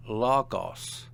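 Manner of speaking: Logos stressed on its first syllable, LO-gos. Shown as a demonstration. Teacher showing an example word, Logos stressed on LO. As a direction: say Logos with the weight on LO. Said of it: "Notice the accent on the penult".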